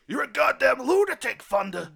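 loud, high angry captain yelling; furious captain. Acted. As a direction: bellow at your loose-cannon cop. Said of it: "He goes".

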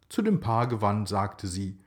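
ordinary delivery

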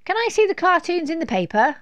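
put-on high, loud little girl; demanding child. A narrator's reading. Laura pesters Mum for the paper, high and loud. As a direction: clamour for a turn, pitched high. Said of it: "asked Laura".

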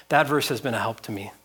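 normal talking voice

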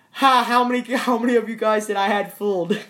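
high, loud, fast gloating; gloating laugh. Real, not acted. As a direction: crow over fooling everyone. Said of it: "gloating at fooled viewers".

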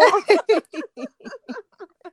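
high burst of laughter. Real, not acted. Laughs about teasing.

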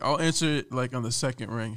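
usual speaking voice

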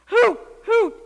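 high, loud, round hoots; owl hoots. A narrator's reading. Owl answers the kitten with high, loud hoots. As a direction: hoot twice like an owl.

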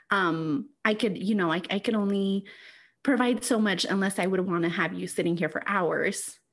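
usual speaking voice

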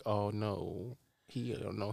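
softly imitated sympathetic phone lady; knowing pity. Admissions lady realizing the applicant hasn't heard, imitated soft pity. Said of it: "I could hear it in her voice".

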